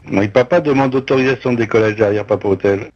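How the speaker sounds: radio call through a headset; clipped pilot radio voice; pilot cockpit headset. Real, not acted.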